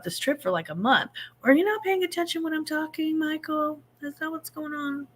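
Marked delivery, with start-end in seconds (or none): 1.4-5.1 high teasing scold; a playful high-pitched "are you even listening"; mock scolding. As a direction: pretend to tell him off.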